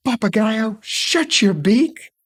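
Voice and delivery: high, drawn-out storybook screech; acted scream, every word stretched out high; angry scream. A narrator's reading. Night creatures fed up with the parrot, angry scream. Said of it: "would get angry and scream".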